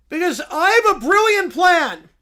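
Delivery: proud, simple creature talk; bear voice. Acted.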